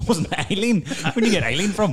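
wysokie pytanie ze śmiechem przez słowa; laughing. Real, not acted. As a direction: parsknij śmiechem, niedowierzając. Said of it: "That's how shit my memory is".

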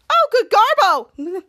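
high, loud, stretched-out cry; shocked exclamation. Acted. Frank seeing his new body, loud shocked exclamation. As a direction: play up the astonishment, slowly and loudly.

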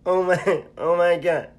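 high, loud, hammed-up comedian impression; Kevin Hart. Friend performing his reply for the audience, Kevin Hart impression. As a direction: wail in exaggerated comic disbelief. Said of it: "Do it in your Kevin Hart voice".

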